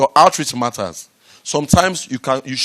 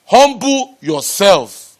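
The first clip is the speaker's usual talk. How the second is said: drawn out, loud and higher; slow emphatic command; 8 semitones higher, 7 dB louder, much slower than usual. Real, not acted. Speaker urges the audience to humility, slow loud emphasis. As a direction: preach it slowly, each word weighed. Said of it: "preached advice to audience".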